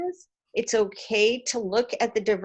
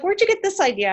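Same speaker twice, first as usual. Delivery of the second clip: mother's surprised question; 10 semitones higher, 5 dB louder, faster than usual. Retold or imitated. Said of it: "she's like".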